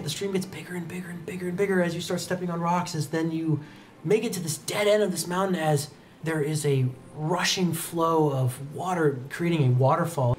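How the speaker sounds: ordinary talk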